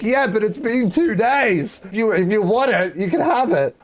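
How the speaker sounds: high-pitched, re-enacted self-justifying; defensive retort. Speaker argues back at roommates.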